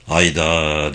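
put-on low-pitched old man's exclamation; understated deep bellow; roaring. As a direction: rumble it low, a restrained roar. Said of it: "diye gürledi yaşlı adam".

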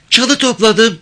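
put-on high-pitched girl's voice; high little reply; village girl. A narrator's reading. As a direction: answer him as a young village girl, pitched well up. Girl questioned by the man.

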